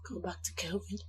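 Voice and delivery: breathless whisper of disbelief. Acted. Wife echoes her husband's question, whispering in disbelief. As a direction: whisper it as if you can't believe it.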